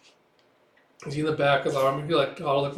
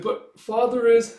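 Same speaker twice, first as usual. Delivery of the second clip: tearful; 9 semitones higher, 6 dB louder, slower than usual. Retold or imitated. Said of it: "she starts tearing up".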